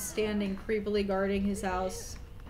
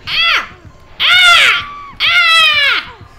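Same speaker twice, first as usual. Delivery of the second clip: screaming; 18 dB louder, much slower than usual. Real, not acted. Scream while hooked in game.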